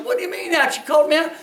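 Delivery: put-on high, disbelieving young player; lightly acted, high-pitched objection; mock indignant protest. Boy protests the call, acted.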